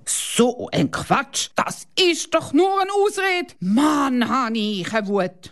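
put-on high, furious outburst from the boy; angry boy. A narrator's reading. Boy rages at the pilot's excuse, high and furious. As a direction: stamp and rage like a thwarted kid. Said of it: "ganz wütend".

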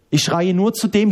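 normal talking voice

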